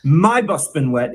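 "my" stressed in "my bus been wet"; wrong stress on MY. Shown as a demonstration. Mock teacher showing students a common error, stress on "my". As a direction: put the weight on "my", the first word. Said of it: "stress on the wrong syllable".

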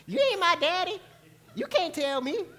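put-on high, loud childish defiance; mock a kid talking back; defiant sass. Mimicking a congregant defying Joshua, high mock sass. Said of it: "Zon just laughing".

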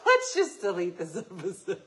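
cracking up through the words; helpless laughter breaking up the line; real laughing fit. Real, not acted. Host embarrassed by their own remark, laughing hard.

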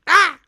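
short, high, loud squawk; sudden comic bird shriek; seagull squawk. Acted. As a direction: imitate a startled seagull. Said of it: "The seagull goes".

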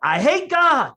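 loud, high-pitched retold shout; blurted outburst. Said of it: "It came out more like".

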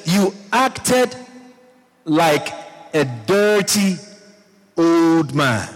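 high-pitched scolding, no real shouting; mock scolding yell. Saul's daughter berates David on his return home, mock scolding. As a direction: act out an indignant wife's telling-off, word by word. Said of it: "Started yelling at him".

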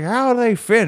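high-pitched mum calling in; imitated impatient mum. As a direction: call through the fitting-room door as mum. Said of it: "She'd be like".

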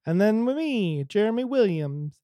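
ordinary talk

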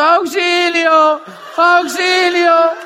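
loud, high, sustained wail; mock cry for help. Beginners stuck at twelve percent calling their upline, mock cry for help. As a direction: draw the word out like a hammed-up distress call.

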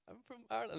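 high-pitched, nervous reply; frightened squeak. Retold or imitated. Answering the man with a gun, frightened squeak.

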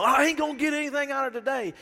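hammed-up sulky complaint; mock grumbling. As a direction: mimic a sulky churchgoer. Mocking a doubtful churchgoer.

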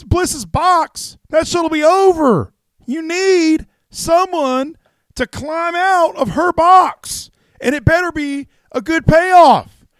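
high, loud promoter rant that keeps building; Jim Cornette impression. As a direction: rant like Cornette about the box, all in the same voice. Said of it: "That was pretty good".

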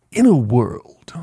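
slow, breathy trailer narrator; movie trailer voice. Shown as a demonstration. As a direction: announce it like a blockbuster trailer. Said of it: "I'm gonna put on my movie voice".

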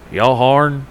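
loud hurried Southern holler; yelling Southern drawl. Retold or imitated. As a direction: call it out from the car window, thick Southern. Customer yelling from car.

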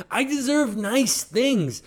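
normal talking voice

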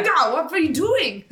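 high, hammed-up German accent; mock German accent. Friend teasing the horse girl, in a mock German accent. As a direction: play the Swedish girl in a silly German accent. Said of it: "that accent".

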